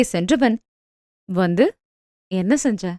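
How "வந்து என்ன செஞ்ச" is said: low, soft, mischievous tease; teasing. A narrator's reading. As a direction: lean in and tease her like a secret. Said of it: "என குறும்பாய் கண்ணடித்தான்".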